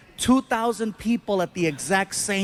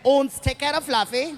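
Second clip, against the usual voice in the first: put-on German accent; 4 semitones higher than usual. Mock German airline staff voice.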